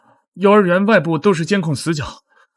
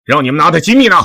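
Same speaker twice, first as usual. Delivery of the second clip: ordinary talk, nothing marked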